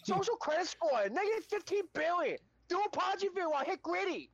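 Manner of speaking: squeaky hammed-up caricature of a Chinese official; mock Chinese accent. Friend joking about a social credit score in a high mock accent. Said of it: "Wrong Chinaman accent".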